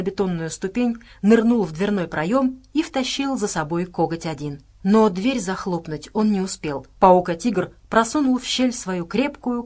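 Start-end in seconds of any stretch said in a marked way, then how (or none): none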